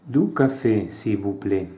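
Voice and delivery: reading out a café dialogue with light character voices; acted dialogue reading. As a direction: play both sides of the café scene, lightly. Reads French café dialogue aloud. (lettura animata di un dialogo in francese)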